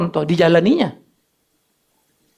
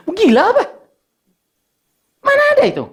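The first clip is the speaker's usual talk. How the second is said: incredulous; 11 semitones higher, faster than usual. Real, not acted. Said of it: "incredulous aside".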